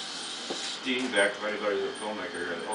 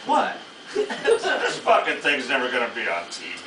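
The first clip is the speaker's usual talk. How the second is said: laughing scoff; 3 semitones higher, 6 dB louder than usual. Retold or imitated. Laughing scoff at director's idea.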